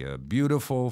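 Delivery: usual speaking voice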